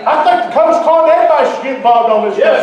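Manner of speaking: fiery preacher building to a shout; impassioned preaching. Real, not acted. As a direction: drive it home to the congregation at full force. Preaches at full force.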